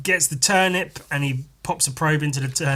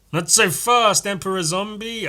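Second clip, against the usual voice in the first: higher, louder heroic call; heroic challenge; 9 semitones higher, 6 dB louder than usual. Acted. Hero stopping Emperor Zombie, high and bold. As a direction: stop the villain in his tracks.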